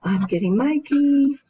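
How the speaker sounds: a sudden loud call to someone off-camera. Real, not acted. Calls out off-camera mid-lesson.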